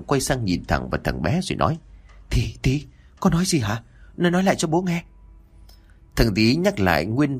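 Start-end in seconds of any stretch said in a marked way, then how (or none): 2.3-5.0 jolted by terror, demand it again; father shocked by his son's words about the ghost. A narrator's reading.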